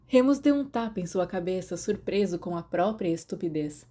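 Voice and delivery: usual speaking voice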